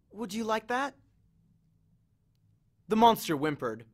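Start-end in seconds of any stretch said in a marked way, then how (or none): 0.1-1.0 high, soft, uncertain question; faint. A narrator's reading. Annabeth offers Cerberus a ball, faintly. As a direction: ask the dog tenderly and faintly. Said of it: "Annabeth promised faintly".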